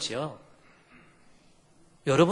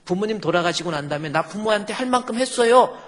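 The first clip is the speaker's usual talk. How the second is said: louder, higher acted self-justification; mock defiant; 6 semitones higher, 5 dB louder than usual. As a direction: play an indignant child insisting on their innocence. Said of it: "눈 짜차시 쳐다보면서 이렇게". (따지듯 높고 큰 소리로 항변하는 흉내)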